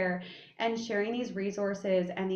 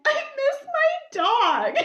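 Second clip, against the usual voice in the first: mock crying; 7 dB louder than usual. Daughter acts out crying to mom about her dog, mock wail. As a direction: bawl it in an exaggerated squeaky sob. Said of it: "I started crying in my apartment".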